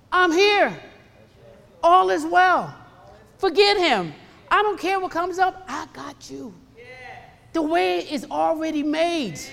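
high, forceful proclamation in separate bursts; emphatic reassurance. Retold or imitated. Preacher voicing the Lord to a worried believer, high and emphatic. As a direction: proclaim comfort with commanding conviction.

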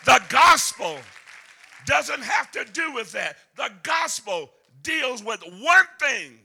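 hammering preacher at full voice; full-force preaching. Acted. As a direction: whoop it out to the congregation. Preaching climax.

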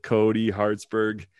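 normal talking voice

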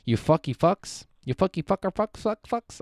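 mock-curse the website like a cartoon; silly swearing. Playfully cursing at the website.

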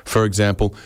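ordinary talk, nothing marked

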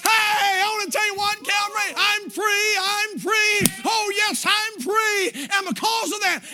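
high, loud, shouting preacher; preaching. Acted. Preacher celebrates his freedom to Calvary church, loud preaching. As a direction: shout your freedom to the congregation.

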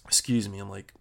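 hiccuping and yawning after a big meal. Real, not acted. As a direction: talk through hiccups and yawns. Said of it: "hiccuping and yawning from all that food".

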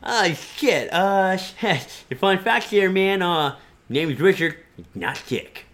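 a lightly voiced Richard correcting people; Richard character. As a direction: correct them about the name, flustered. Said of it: "it's a character that I have".

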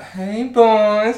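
high, loud, drawn-out greeting; hammed-up high singsong hail; beach woman's come-on. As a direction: imitate her calling over the lads. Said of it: "And she's like".

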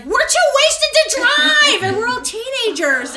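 hammed-up drunk excuse; loud whine. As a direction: protest like a wasted teen. Teens answering angry mother.

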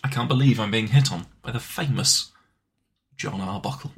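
low, hushed disbelief; quiet game-soldier voice; gruff action-hero parody. Acted. Continuing Solid Snake parody.

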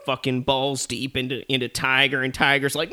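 ordinary talk, nothing marked

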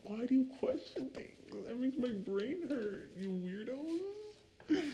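high, soft, drawn-out mock bafflement; mock whining complaint. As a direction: act baffled in a high voice, stretching the last word. Mock complaint in acted quote.

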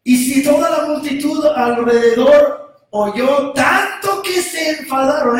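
ordinary delivery

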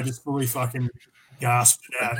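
usual speaking voice

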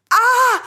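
sharp high cry of pain; pained scream. Acted. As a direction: yelp as if burned. Wax burns in eye.

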